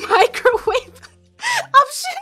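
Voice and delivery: ordinary delivery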